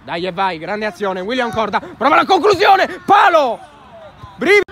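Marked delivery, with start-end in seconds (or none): 1.8-4.6 loud high shout at the post; shouting commentary. Real, not acted. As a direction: yell as the ball hits the post. Shot hits the post. (urlo di telecronaca)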